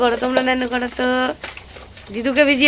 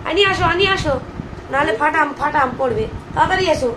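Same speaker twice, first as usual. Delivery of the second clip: coaxing call; 5 semitones higher, 6 dB quieter than usual. Real, not acted. Calling toddler to come. (শিশুকে আদুরে ডাক)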